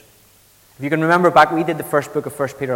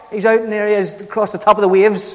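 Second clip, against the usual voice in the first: ordinary delivery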